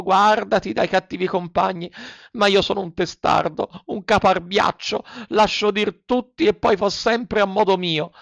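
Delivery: put-on high, pleading lament, in a child's distressed voice; weeping desperately. A narrator's reading. Pinocchio weeps over his fallen schoolmate, high and desperate. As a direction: wail over your friend as if it were all your fault. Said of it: "piangendo dirottamente, e, disperandosi".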